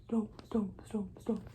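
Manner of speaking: the stomp chant whispered low; the elephant chant repeated in a whisper. Acted.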